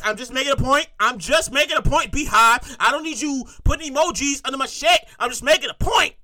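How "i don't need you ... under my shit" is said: ordinary talk, nothing marked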